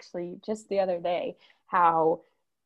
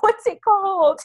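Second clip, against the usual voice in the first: much higher and louder, curious imitation; eager drive-through guy asking; excited; 12 semitones higher, 8 dB louder than usual. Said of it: "And he's like".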